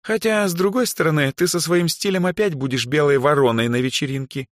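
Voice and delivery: high, drawn-in teasing voice; high-pitched girlish jibe. A narrator's reading. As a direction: needle a friend in a high pitch. Даяна teases her friend.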